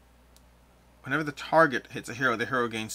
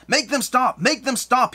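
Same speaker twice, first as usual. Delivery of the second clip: high, loud, tormented plea; moaning ghost; 11 semitones higher, 6 dB louder, slower than usual. Acted. Ghost of a man pleading for it to stop, moaning loudly. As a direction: cry out like a tortured ghost, pitched well up. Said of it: "he moans as he moves back and forth".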